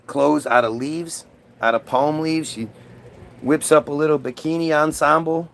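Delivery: normal talking voice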